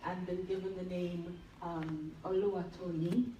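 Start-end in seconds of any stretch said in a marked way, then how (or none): none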